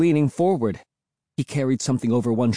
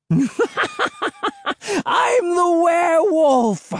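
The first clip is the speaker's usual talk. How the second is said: put-on a madman screaming as he chases the kids; crazed shriek. A narrator's reading. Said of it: "the hermit shrieked".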